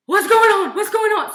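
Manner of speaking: high, loud panicked repeat; retold panic. Boyfriend reading her hospital text, high loud panic. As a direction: blurt it twice like you just woke to bad news. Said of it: "completely panicking".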